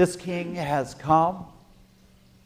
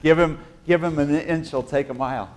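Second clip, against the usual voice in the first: ordinary talk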